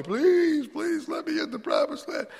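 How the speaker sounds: high, pleading cry of Moses begging to enter; desperate begging. Retold or imitated. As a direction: plead with him, voice high and straining.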